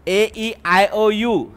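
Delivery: vowels ए ई आय ओ यू spelled out very slowly; teacher reciting the vowel list very slowly; slow recital. Shown as a demonstration. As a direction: tick off each vowel one by one. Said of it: "listing vowels slowly".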